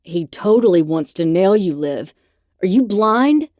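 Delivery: loud jealous outburst; growled. Acted. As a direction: snap it at her, loud. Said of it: "The bear growled".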